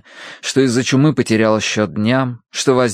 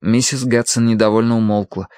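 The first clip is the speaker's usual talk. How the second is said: usual speaking voice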